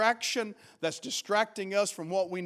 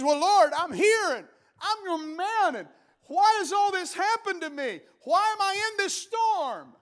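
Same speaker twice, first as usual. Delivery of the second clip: higher pleading voice, rising to a shout; Elijah complaining; 8 semitones higher than usual. Acted. Elijah complains to God, higher and pleading. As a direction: plead your case to God, voice climbing.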